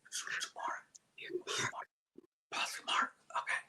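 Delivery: conspiratorial whisper. Retold or imitated. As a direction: drag it out in a secret whisper. Retelling whispered plotting.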